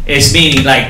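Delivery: ordinary talk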